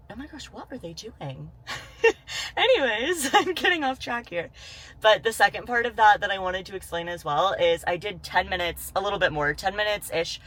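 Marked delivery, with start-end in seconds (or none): 1.7-4.5 laughing. Real, not acted. Laughing it off after the scare.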